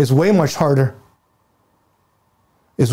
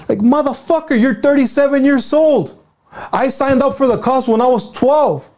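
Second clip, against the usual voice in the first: raised, worked-up comeback at a caller; heated retort; 7 semitones higher than usual. Real, not acted. Fired up, snapping back at the caller who said he let them down. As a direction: let the anger climb as you answer back.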